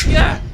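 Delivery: sudden loud high yelp of fright; startled yell. Real, not acted. Owl swoops over the digger's head, startled yell. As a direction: jump out of your skin and yell.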